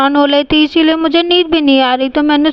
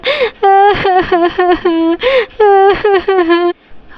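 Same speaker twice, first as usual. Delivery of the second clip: put-on child sobbing; 5 semitones higher than usual. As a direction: cry like a scolded little girl. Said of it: "ये रोने की आवाज़".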